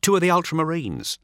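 high, nervous voice; Vilteth reporting. Acted. Vilteth explaining the ambush to his master, high and nervous. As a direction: blurt out the excuse. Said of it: "says Vilteth".

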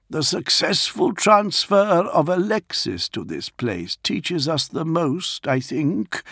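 polite high-pitched Warsmith addressing his lord; Dantioch's voice. A narrator's reading. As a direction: speak up high and careful as the loyal Warsmith. Dantioch explains rescue.

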